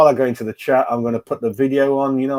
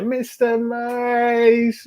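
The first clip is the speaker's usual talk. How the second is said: drawn-out call; 11 semitones higher, much slower than usual. Real, not acted. Calling out a chat regular.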